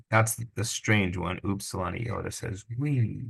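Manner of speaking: ordinary talk, nothing marked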